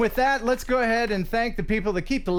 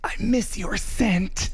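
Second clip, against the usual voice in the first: mock romantic; slower than usual. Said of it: "and then I said".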